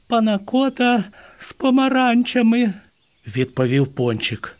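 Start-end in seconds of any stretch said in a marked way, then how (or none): 0.1-2.7 high, squeaky little elf voice; dazed elf. A narrator's reading. Dazed elf answering a belly question with dessert, high dreamy voice.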